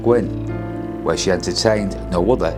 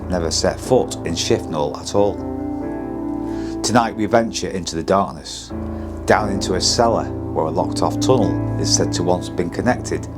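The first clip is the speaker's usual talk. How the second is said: measured, ominous ghost-show voiceover; documentary narration; 12 semitones lower than usual. A narrator's reading. Spooky documentary voiceover over music.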